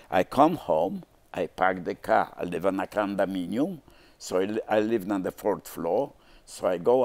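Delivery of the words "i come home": ordinary delivery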